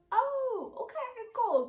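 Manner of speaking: mock-surprised "oh, okay, cool" pitched high and loud; high, loud stunned coworker. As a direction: play a coworker caught off guard, voice jumping high. Coworkers' shocked reaction voiced.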